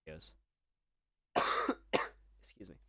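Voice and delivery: usual speaking voice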